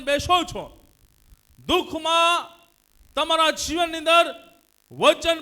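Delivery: high, loud sermon delivery; full-force preaching. Real, not acted. Preacher urging believers to pray in sorrow, full-force preaching. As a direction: drive each phrase out to the congregation.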